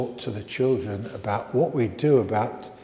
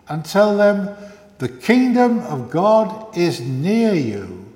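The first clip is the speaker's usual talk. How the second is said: measured, louder scripture reading; gospel reading; 5 semitones higher, 8 dB louder than usual. Acted. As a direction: proclaim the gospel to the church. Reads the gospel aloud.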